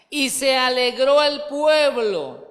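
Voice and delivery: loud, slow scripture proclamation; emphatic proclaiming. Real, not acted. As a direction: proclaim it to the whole congregation. Scripture read out with force.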